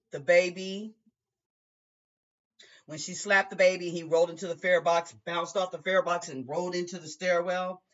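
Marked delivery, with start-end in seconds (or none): none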